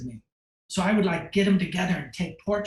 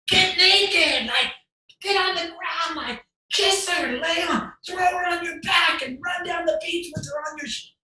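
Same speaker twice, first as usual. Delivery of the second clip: much higher, loud, excited calls across the beach; shouted directions; 13 semitones higher, 4 dB louder than usual. Retold or imitated. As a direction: yell orders to the models, wild and thrilled.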